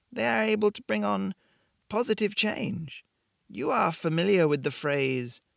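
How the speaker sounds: put-on calm, knowing woman with an accent from somewhere in Europe; vague European accent. A narrator's reading. Shopkeeper tells Blake why he came, vague European accent. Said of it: "Her accent was impossible to place".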